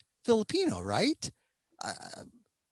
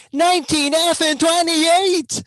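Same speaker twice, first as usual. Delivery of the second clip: loud, high and drawn out; exasperated outburst; 10 semitones higher, 7 dB louder than usual. Acted. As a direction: exclaim the year slowly in high exasperation. Exasperated repeat of the outdated year.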